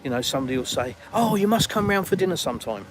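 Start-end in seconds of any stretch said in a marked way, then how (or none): 1.1-2.9 higher, gushing mock politeness; insincere invitation. Westerner making an empty dinner invitation, falsely enthusiastic. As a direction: toss out a showy invite you don't mean. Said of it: "it's not sincere, it's not genuine".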